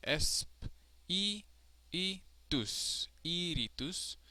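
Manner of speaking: esp-i-itus broken up around a long i; stressed syllable isolated. Shown as a demonstration. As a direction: hold the i in esp-i-itus and iritus. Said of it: "is the third to last".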